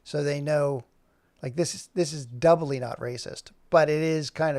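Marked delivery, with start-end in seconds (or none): none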